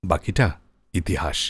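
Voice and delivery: ordinary talk, nothing marked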